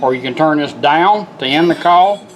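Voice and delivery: normal talking voice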